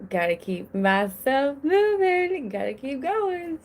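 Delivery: high, singsong self-urging; restless self-talk. Acted. Mother voicing how she copes with busy brain, restless self-talk. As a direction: push yourself along in a high, lilting voice. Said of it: "I'm just like".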